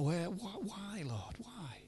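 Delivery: imagined Paul questioning God, in a hushed anguished whisper. Retold or imitated. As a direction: let it sink to a broken whisper.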